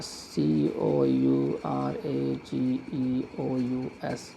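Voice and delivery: slow, low, soft letter-by-letter spelling; spelled out slowly. Shown as a demonstration. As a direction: spell it softly, one letter at a time. Teacher spelling while writing. (धीमी आवाज़ में एक-एक अक्षर)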